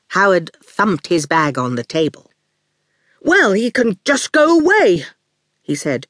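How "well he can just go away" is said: higher-pitched, not quite fierce dismissal; half-fierce. A narrator's reading. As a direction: try to sound fierce and fall short. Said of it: "It didn't come out as fierce as he had hoped".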